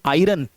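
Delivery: आयरन with a sounded R, "eye-run"; wrong pronunciation. Shown as a demonstration. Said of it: "गलत pronounce करते हैं".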